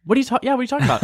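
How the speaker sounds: high, confused question; puzzled. Retold or imitated. Roommate voicing her friend's blank confusion, high puzzled question. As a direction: sound genuinely lost. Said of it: "And she was like".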